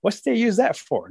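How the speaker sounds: put-on high, curious child's question; imitated inquisitive child; little child. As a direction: ask it high, like a kid. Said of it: "little boy or girl's gonna say".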